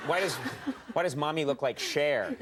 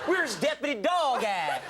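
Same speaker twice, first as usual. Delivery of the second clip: slow, higher, hammed-up Southern drawl; mock Southern accent; 6 semitones higher, slower than usual. Host teasing guest's habit, mock Southern accent. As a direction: make fun with a laughing Southern twang. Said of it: "think you're making fun of them".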